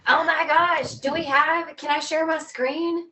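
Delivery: high, loud and eager; suddenly thrilled, rushing to show something; excited burst. Real, not acted. Suddenly remembers to show photos.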